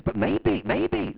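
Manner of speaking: loud, high mock wonder on maybe; mocking scientist. Preacher mocking the TV scientist, loud and high. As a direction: ham up the scientist's big maybe. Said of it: "then he says".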